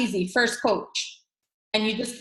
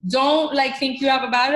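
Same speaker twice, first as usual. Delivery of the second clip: ordinary delivery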